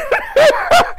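high loud burst of laughter; real high shrieking laugh. Real, not acted. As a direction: crack up out loud. Shouting laugh after the punchline.

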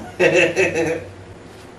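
real, loud chuckle; a run of laughter at your own joke. Real, not acted. Jokes about sparing viewers singing.

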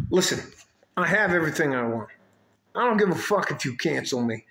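usual speaking voice

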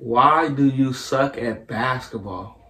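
slow, evenly spaced words; slow delivery. Real, not acted. Player teases friend in whisper challenge, slowly. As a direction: say it slowly for a lip-reader.

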